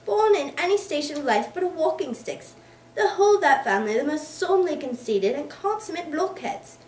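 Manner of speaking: ranting volley of insults; angry volley. Acted. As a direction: fire off the abuse furiously. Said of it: "suddenly firing another volley".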